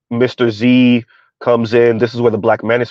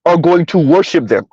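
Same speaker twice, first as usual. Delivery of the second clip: ordinary delivery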